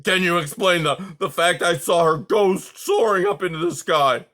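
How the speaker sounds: put-on loud agitated old voice; agitated old porcupine. A narrator's reading. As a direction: insist like a worked-up old man.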